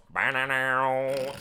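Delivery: slow, loud vocal guitar lick; imitated guitar riff. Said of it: "and it goes".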